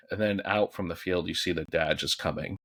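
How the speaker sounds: ordinary delivery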